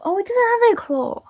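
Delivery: high surprised exclamation. Real, not acted. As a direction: realize it with surprise. Surprised realization.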